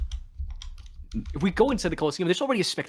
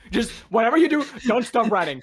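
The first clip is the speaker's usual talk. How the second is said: urgent and raised; urgent, excited; 5 semitones higher, 4 dB louder than usual. Acted. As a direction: urge them on, loud and high. Urgent in-character plea, running.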